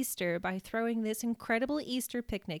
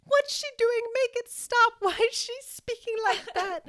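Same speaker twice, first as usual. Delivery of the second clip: very high, loud mock shriek, breaking into laughter; hammed-up frantic shrieking; imitated horrified screaming; 14 semitones higher, 6 dB louder than usual. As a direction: act out a horrified grandma screaming for help. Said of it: "she starts screaming to my mom".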